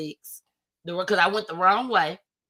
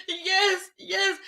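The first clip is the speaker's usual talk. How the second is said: retold tearful answer; 10 semitones higher than usual. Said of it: "I'm still boohoo crying".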